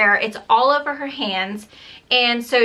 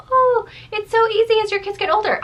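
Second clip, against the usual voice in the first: high, sing-song mocking of people's advice; parroting a breezy cliché in a mocking voice; mimicking everyone; 11 semitones higher than usual. Mom mocking people who say it gets easier, sing-song. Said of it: "And everyone says".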